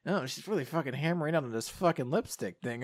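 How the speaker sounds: put-on annoyed girl muttering to herself; muttered grumble. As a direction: grumble it under your breath. Exasperated inner grumble.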